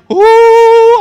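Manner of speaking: loud, high mock "ooh"; drawn-out wail of mock effort; worked-up wail. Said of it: "You don't gotta work yourself up to it like".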